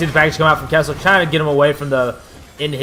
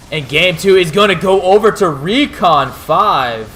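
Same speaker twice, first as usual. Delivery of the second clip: victory call; 4 semitones higher, slower than usual. Real, not acted. Announcing the game winner.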